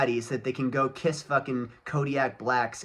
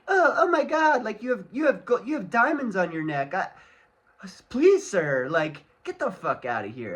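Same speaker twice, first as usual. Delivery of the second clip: mocking fawning girl; 7 semitones higher, 5 dB louder than usual. Mocking women fawning over rapper.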